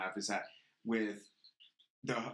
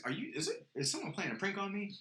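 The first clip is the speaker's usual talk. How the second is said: incredulous contractor; 9 semitones higher than usual. Retold or imitated. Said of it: "he says".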